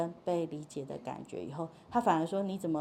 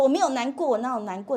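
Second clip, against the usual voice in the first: raised, higher mock protest; protesting denial; 4 semitones higher, 6 dB louder, faster than usual. Child answering parent's guess about sadness, raised-voice denial. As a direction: deny it quickly and insistently.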